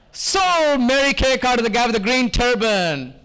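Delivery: drawn-out, ringing auctioneer announcement; auctioneer's sold call. Acted. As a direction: call the sale to the whole room, stretching the first word. Auctioneer calls the winning bid.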